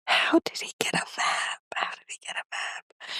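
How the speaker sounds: breathy disbelieving whisper. Real, not acted. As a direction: whisper it in shock.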